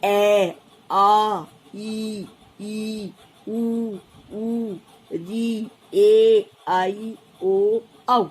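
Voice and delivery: vowels said one by one, drawn out; reciting vowels. Shown as a demonstration. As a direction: recite each vowel slowly and clearly. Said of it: "जैसे हम बोल सकते हैं". (स्वर धीरे-धीरे अलग-अलग बोलना)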